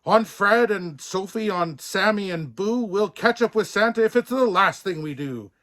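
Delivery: loud, high rallying call; calling the team. Acted. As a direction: urge the penguins on like reindeer. Buck calls to his team.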